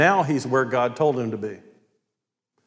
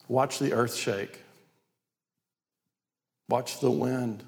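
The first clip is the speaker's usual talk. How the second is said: soft, understated show of power; quiet command; 2 semitones lower, 7 dB quieter, slower than usual. Acted. God showing Elijah who he is, quiet command.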